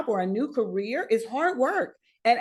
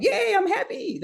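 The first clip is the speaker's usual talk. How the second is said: much higher, bubbly little cheer; cheery yay; 11 semitones higher than usual. Retold or imitated. Speaker showing how she really talks, bubbly high cheer. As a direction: squeal it like a happy kid. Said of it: "I'm like".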